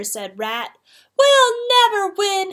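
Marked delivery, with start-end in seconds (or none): 1.2-2.5 high, loud howl of despair. A narrator's reading. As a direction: howl it hopelessly, like a storybook cat. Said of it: "howled Cat".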